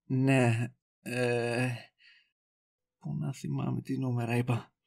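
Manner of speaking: very soft, low hesitation; evasive mumbling. Acted. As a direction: stall under your breath. Stalling over forgotten phone number. (χαμηλόφωνο, διστακτικό μουρμουρητό υπεκφυγής)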